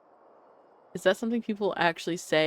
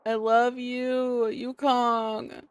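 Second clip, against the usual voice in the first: doting; 4 semitones higher, much slower than usual. Real, not acted. Gushing at Yukong reunion.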